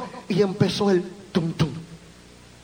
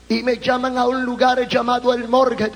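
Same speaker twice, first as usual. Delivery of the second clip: ordinary talk, nothing marked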